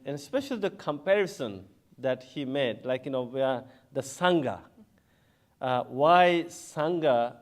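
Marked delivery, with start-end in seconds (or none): none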